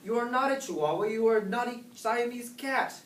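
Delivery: high, emphatic motherly telling-off; scolding. A narrator's reading. Mama corrects her kitten's chihuahua idea, high-pitched scolding. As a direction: set the child straight, pitched well up. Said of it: "she scolded".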